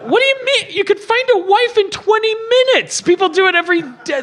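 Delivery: high, incredulous retort; mock incredulous. Host retelling his reply to the movie hero, incredulous. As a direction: scoff at him in disbelief. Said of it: "And I'm like".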